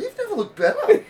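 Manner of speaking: very high, gushing compliment; mock admirer. People greeting him after a month away, mock gushing. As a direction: squeal it like an overexcited fan. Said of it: "they're like".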